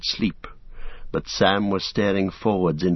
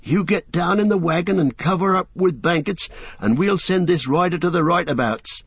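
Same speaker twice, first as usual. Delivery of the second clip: higher, urgent worried servant's voice; anxious Sam; 9 semitones higher than usual. A narrator's reading. Sam urging Frodo to hide from the rider, anxious. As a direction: press him to hide, nervous. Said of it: "said Sam anxiously".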